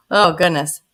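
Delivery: ordinary talk, nothing marked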